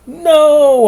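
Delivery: loud, high yelled denial; a boy yells no in a panic; hollered no. A narrator's reading. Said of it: "I hollered".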